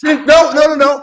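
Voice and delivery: high, loud repeated no; loud insistent denial; loud protest. Real, not acted. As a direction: shout him down. Heated argument, cutting in.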